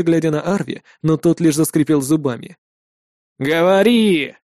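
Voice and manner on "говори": firm, drawn-out order; command. A narrator's reading. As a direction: order him to speak. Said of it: "приказал один из молодых воинов". (приказным тоном)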